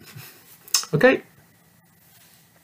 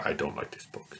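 whispered pupil quote; 6 semitones lower, 13 dB quieter, faster than usual. Pretend. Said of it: "bad book?".

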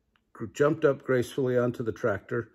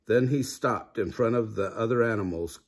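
ordinary delivery, nothing marked

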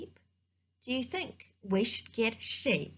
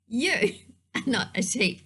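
ordinary delivery

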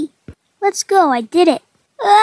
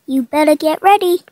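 ordinary talk, nothing marked

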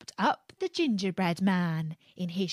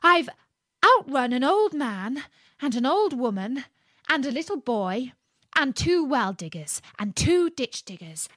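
louder, rising brag; loud call; 5 semitones higher, 4 dB louder than usual. Acted. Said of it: "called out in a very loud voice".

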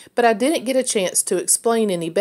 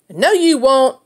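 loud, higher taunting shout; shouting back; 8 semitones higher, 6 dB louder than usual. A narrator's reading. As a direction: yell it back smugly. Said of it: "Erland shouted back".

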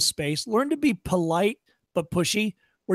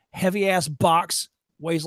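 normal talking voice

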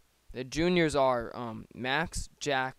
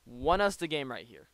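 usual speaking voice

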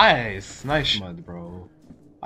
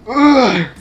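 1 angry grunt; 7 semitones higher, 7 dB louder than usual. Real, not acted. Reacting to being killed.

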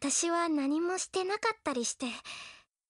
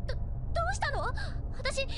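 usual speaking voice

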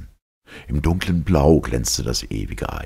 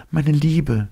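"meine Liebe" spoken high and tender, falling at the end; young woman's voice; 13 semitones higher, 3 dB louder than usual. Acted. Elita voiced by narrator. ("meine Liebe" hoch und zärtlich, fallend gesprochen)

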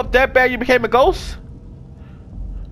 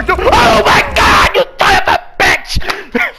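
loud slow outraged yell; real outraged shout, higher and slow; 9 semitones higher, 4 dB louder, slower than usual. Real, not acted. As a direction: yell at the one who got you. Caught by the enemy.

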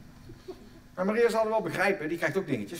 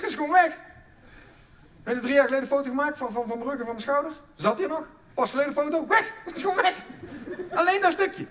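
excited disbelief; 6 semitones higher, 4 dB louder than usual. Real, not acted. Excited retelling of missing vertebra.

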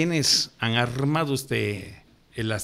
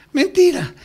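"Mentira" shot out much higher and louder; indignant outburst; 5 dB louder than usual. Real, not acted. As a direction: explode with a scornful denial. Emphatic rebuttal of history claim. (estallido indignado: "¡Mentira!", mucho más agudo y fuerte)

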